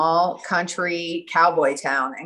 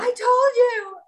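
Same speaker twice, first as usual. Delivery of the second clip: very high, loud imitated scream; 4 dB louder than usual. Imitating friend on the phone after the news, screaming. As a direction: imitate a friend's triumphant I-told-you-so shriek. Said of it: "And she's screaming".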